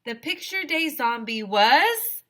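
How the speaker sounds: building to a loud, high announcement; dramatic reveal. A narrator's reading. A narrator unveiling the zombie's identity to the listener. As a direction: belt out the name as the big reveal.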